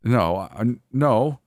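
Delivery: flustered, broken denial rising in pitch; halting and thrown; stammering. A narrator's reading. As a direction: stumble over the words in protest. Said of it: "I stammered".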